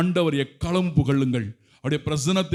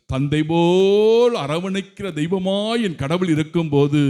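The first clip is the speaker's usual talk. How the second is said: higher, building exalted praise; rising praise; 5 semitones higher than usual. Real, not acted. Preacher praising God in a rising ecstatic voice.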